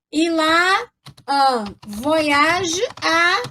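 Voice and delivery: Il a un voyage à said very slowly in French; French pronunciation. Shown as a demonstration. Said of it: "Lembra que eu falo?". (pronúncia francesa, bem devagar)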